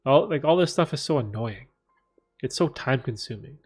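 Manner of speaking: usual speaking voice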